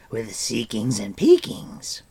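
a small creature boasting brightly; eager creature cry; Gurgi creature cry. A narrator's reading. Gurgi voiced.

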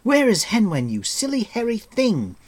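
high, loud angry outburst. A narrator's reading. Taran bursting out at Gurgi, high and loud. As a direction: burst out in anger. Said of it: "he burst out".

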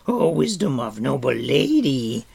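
high, loud adoring cry; fawning creature's outcry; Gurki, crying out. A narrator's reading. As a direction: exclaim it in worshipful creature voice. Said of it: "Gurki cried".

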